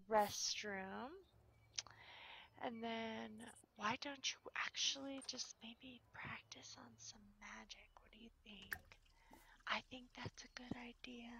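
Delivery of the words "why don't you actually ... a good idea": soft unvoiced whisper. Real, not acted. Player whispering suggestions to her Sim, softer. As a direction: hush it like someone is sleeping. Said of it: "I don't know why I'm whispering".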